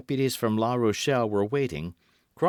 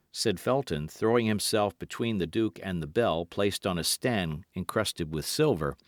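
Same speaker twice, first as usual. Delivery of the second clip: ordinary delivery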